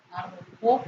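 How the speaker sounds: arrojó ending on a lifted, stressed JÓ; stress on last syllable. Shown as a demonstration. Teacher confirming the written accent, arrojó stressed on JÓ. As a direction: lean on the final JÓ of arrojó. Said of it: "mayor intensidad en la última sílaba".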